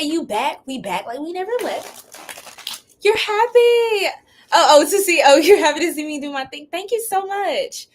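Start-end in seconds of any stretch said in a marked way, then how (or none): 3.0-7.9 high, loud, laughing and gushing; laughing delight. Real, not acted. Streamer touched by a returning viewer, laughing. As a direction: laugh through the words, touched. Viewer happy to see her.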